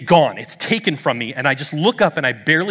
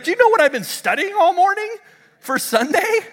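very high, incredulous squeal; high-pitched rising amazement; amazed question; 4 dB louder than usual. Retold or imitated. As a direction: retell it in hammed-up disbelief. Acted amazed question to friend.

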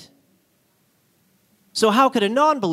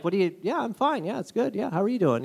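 softer, offhand reply; casual shrug; 6 dB quieter, faster than usual. Retold or imitated. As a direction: brush it off lightly. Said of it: "He's like".

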